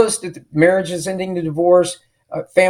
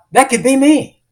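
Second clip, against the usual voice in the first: high-pitched, hopeful exclamation; inspired realization; 8 semitones higher, 3 dB louder than usual. Retold or imitated. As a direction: voice lifts with imagined excitement. Imagined empowered reaction to success story.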